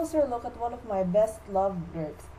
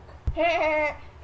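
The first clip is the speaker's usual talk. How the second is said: loud, higher mocking laugh; gloating laugh; 8 semitones higher, 4 dB louder than usual. Playwright gloating at old classmates, triumphant laugh.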